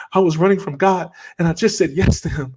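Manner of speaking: very high-pitched, acted-out elation; retold excited outburst. Patient telling the startled doctor about God, excited. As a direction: blurt it out high, re-enacting the excitement.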